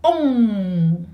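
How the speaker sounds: Ong ending nasal at the back of the mouth. Shown as a demonstration. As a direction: let Ong ring in the back of the nose. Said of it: "Nasal final in the back".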